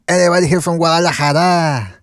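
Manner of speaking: put-on Spanish-style Mexican accent. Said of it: "he's gonna do a Mexican accent".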